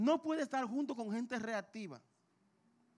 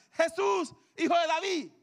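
loud high plea to Jesús; crying out; 5 semitones higher, 4 dB louder than usual. Retold or imitated. Blind man crying out to Jesús, loud high plea. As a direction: call out over the crowd, pleading. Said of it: "comenzó a vociferar".

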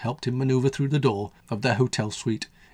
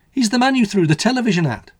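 higher, lightly voiced boy; a light child voice; 10 semitones higher, 5 dB louder than usual. A narrator's reading. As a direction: answer like an innocent kid. Child Billy tells father bluntly.

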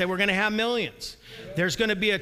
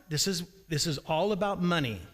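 normal talking voice

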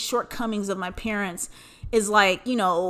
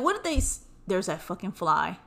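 normal talking voice

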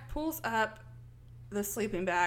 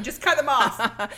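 loud, slow blunt order; mock blunt order; 8 dB louder, slower than usual. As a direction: roep het luid en langzaam. Mateus pops up, quoted.

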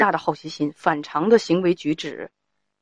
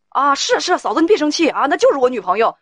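high, louder eager fake reassurance; smooth it over like a lying pal, pitched up; placating buddy covering; 8 semitones higher, 3 dB louder than usual. Friend lying to the wife to cover for him, eager and placating.

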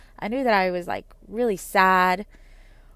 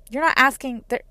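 usual speaking voice

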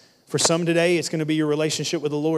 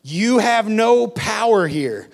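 higher, louder and slow, hit "no"; forceful declaration; 6 semitones higher, 6 dB louder, slower than usual. Acted. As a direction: proclaim it like a command over enemies. Repeated louder, slower.

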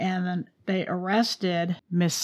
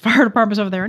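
ordinary talk, nothing marked

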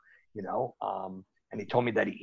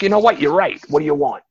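louder, higher mock exasperated caving; loud give-in; 7 semitones higher, 13 dB louder than usual. Bookie loudly caving to the late caller's bet. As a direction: throw up your hands and shout yes.

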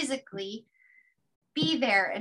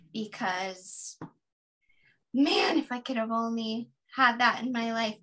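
ordinary talk

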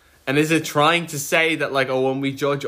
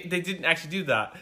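normal talking voice

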